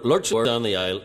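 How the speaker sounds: ordinary delivery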